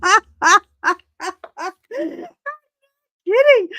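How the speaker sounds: high, loud laughing insistence; laughing disbelief. Real, not acted. Insisting to listeners it is true, laughing high. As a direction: laugh it out, still amazed.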